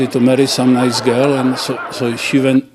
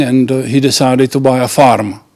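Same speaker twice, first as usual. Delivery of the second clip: usual speaking voice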